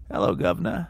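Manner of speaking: low, flirtatious politician impression; Bill Clinton. Shown as a demonstration. Host performing on co-host's request, flirty presidential impression. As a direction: say it like hitting on someone. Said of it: "as Bill Clinton".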